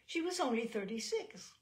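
ordinary delivery

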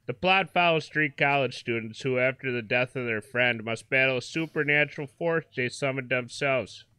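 hammed-up Wisconsin reader, folksy and chatty; put-on Midwestern Wisconsin voice; Wisconsin accent. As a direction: read it out in a nice Wisconsin accent. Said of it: "read her in a nice Wisconsin accent".

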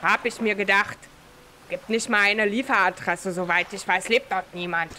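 lightly voiced dismissive local; low, matter-of-fact character reply; game character voice.

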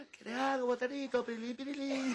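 high, cooing, hammed-up sweetness; sweet girlfriend voice. Husband mocking the girlfriend he wishes were nursing him, high cooing. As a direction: mimic a doting young lover. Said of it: "Haciéndome un cariñito".

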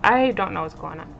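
ordinary talk

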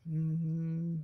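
low, soft humming on one note; gentle hum. Shown as a demonstration. Voice coach showing viewers a starting note, gentle hum. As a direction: hum a comfortable steady note. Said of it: "just do some gentle humming".

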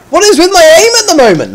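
high-pitched frustrated whine; exasperated complaint. Real, not acted. Gamer fed up with his aim, exasperated complaint.

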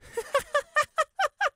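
long, high, loud giggling; mocking laugh. A narrator's reading. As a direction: peal of shrieky high laughter. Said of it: "he laughed".

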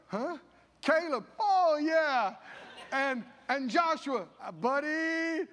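overjoyed at being spared; delighted laughing surprise. Retold or imitated. God naming Caleb and Joshua.

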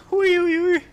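high loud squeals; squealing. Real, not acted. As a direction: squeal in shocked delight. Reacting to scare, squeals. (hohes Quieken)

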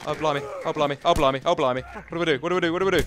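loud flustered repeats; panicky. Real, not acted. Gamer under attack asking the chat, panicky.

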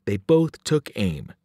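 ordinary delivery

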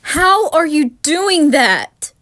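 loud, slow, rattled question; scared shout. Acted. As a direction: demand an answer, frightened. Frightened at someone in garden.

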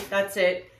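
ordinary talk, nothing marked

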